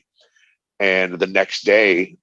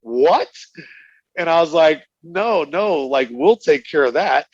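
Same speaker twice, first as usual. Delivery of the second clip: laughing disbelief; 7 semitones higher than usual. Real, not acted. Retelling reaction while laughing.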